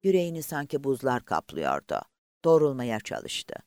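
ordinary delivery, nothing marked

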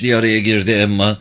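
plain reading voice

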